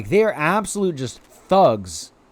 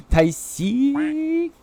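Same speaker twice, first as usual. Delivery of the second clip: sing-song call; 11 semitones higher than usual. Acted. Calling a pal's name.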